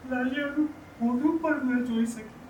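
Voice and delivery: high-pitched mournful lament; pained, high wail easing off in volume; grieving lament. Acted. Laments wife missed seeing grandchild.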